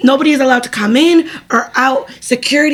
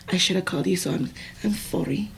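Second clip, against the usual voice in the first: much softer, sincere apology; 5 semitones lower, 12 dB quieter than usual. Real, not acted. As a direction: say sorry gently, really meaning it. Apologizing now to her listener.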